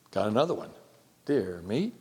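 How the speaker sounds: normal talking voice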